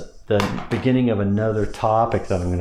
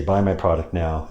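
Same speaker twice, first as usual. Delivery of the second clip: lowered embedded command; lower pitch; 4 semitones lower than usual. Shown as a demonstration. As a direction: drop the voice for Buy my product now. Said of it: "I lower my voice when I say those words".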